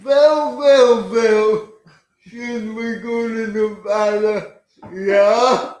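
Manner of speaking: put-on loud Hungarian accent, w said as v. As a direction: Hungarian accent with a hammy vvell. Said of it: "give us a little of that now".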